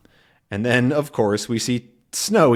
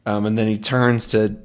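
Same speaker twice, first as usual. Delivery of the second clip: ordinary delivery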